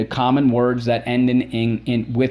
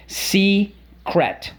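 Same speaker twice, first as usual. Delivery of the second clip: modelling the word; 9 semitones higher than usual. Shown as a demonstration. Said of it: "What's the word".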